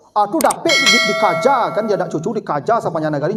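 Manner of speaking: ordinary delivery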